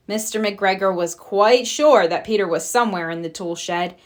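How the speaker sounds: plain reading voice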